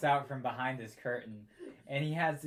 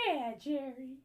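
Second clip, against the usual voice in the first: squeaky mock echo; 11 semitones higher, 3 dB quieter than usual. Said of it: "goes like".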